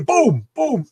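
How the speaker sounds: high, punchy spoken gunshots; voiced bang sound effect; imitated gunshots. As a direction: cut in with mock gun blasts. Imitates gunshots cutting off the plea.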